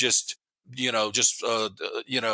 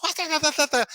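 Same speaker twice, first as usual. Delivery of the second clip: mock angry cop; 14 semitones higher than usual. Cop running up to the car door, mock angry yelling. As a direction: play the pissed cop yelling at the kid. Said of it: "he comes running up to my door all pissed".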